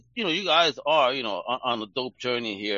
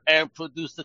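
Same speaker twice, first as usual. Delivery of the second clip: ordinary talk, nothing marked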